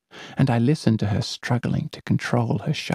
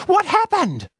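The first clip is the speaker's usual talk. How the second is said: very high shrieked question; scream; 5 dB louder than usual. Acted. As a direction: scream in shock. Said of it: "screams the girl".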